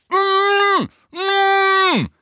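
very high, loud muffled mm; muffled shout through gag. A narrator's reading. Ron protesting through his gag, muffled. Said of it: "rief Ron durch seinen Knebel".

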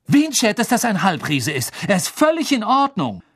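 high, loud outburst; angry defence. A narrator's reading. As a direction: protest hotly on a friend's behalf. Harry angrily defends Hagrid.

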